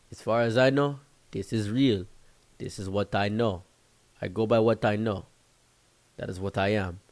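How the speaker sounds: imitated African accent, deliberate pauses. African friend insisting this is real, African accent. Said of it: "And he said".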